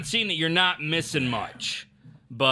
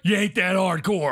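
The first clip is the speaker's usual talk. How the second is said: Stone Cold voice; 4 semitones higher than usual. Shown as a demonstration. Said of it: "that was a stone cold".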